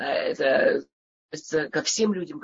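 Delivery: ordinary talk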